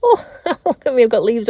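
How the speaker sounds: a high, loud burst of laughter at herself; amused at leaves caught in her hair; laughing. Real, not acted.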